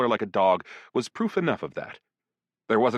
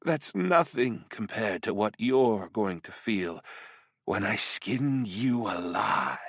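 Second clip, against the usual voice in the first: slow, teasing demon seductress; flirtatious demoness; much slower than usual. Acted. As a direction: purr each threat slowly and playfully. Voices the succubus.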